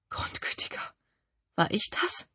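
tonlos geflüstert vor Schreck; entsetztes Flüstern. A narrator's reading. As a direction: hauch es fassungslos. Entsetzt beim Anblick des Verbrannten. (geflüstertes Entsetzen)

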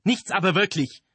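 high, loud scornful retort; dismissive. A narrator's reading. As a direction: brush the objection aside loudly. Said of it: "wischte der den Einwand ... beiseite".

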